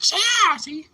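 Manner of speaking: high, loud exclaim; bright excited yeah to the viewers; excited show-off. Real, not acted. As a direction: show off the big onion. Showing big onion.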